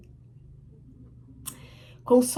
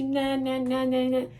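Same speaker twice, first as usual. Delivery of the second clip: droning imitation of a wailing child; mock tantrum; 2 semitones higher, slower than usual. Child throwing a tantrum at mother, mock wailing. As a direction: mimic a kid fussing on the floor. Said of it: "chora, espirra e, e faz pirraça".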